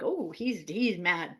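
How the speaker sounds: hushed aside. Retold or imitated. Menaka noticing his anger.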